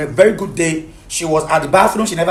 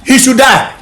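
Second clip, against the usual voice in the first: raised, higher, furious voice; angry outburst; 6 semitones higher, 4 dB louder than usual. Real, not acted. Husband to mediator about wife's ex, angry outburst. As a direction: burst out in anger.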